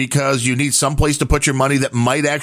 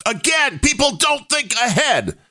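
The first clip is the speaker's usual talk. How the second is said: exasperated; 12 semitones higher than usual. Real, not acted. Exasperated raised voice.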